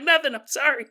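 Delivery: put-on high, sheepish old lady, caught out; mock sheepish apology. Great-grandma caught muttering, sheepish high apology. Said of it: "She's like".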